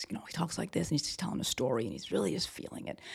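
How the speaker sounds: soft, hushed storytelling impression. Podcaster imitating a singer telling a story, hushed.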